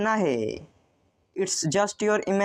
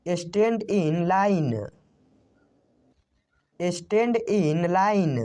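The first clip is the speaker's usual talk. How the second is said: slow model reading; much slower than usual. Shown as a demonstration. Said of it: "teacher models English sentence".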